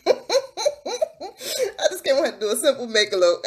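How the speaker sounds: giggling through the repeated line; laughing repeat. Real, not acted. Laughing as she tells viewers she only wanted a makeup look. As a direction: laugh as you say it again.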